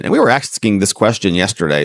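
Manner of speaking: usual speaking voice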